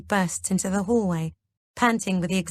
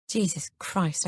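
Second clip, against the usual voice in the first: ordinary delivery, nothing marked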